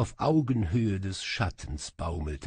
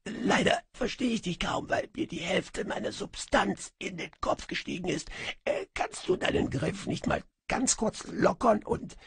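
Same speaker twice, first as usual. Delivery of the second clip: high, half-whispered, choked voice; strangled stammer; 11 semitones higher than usual. A narrator's reading. Djinn, held by the throat, pleads in a high strangled whisper. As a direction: squeak out the words while being strangled. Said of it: "stammelte ich mit geschwollenen Lippen".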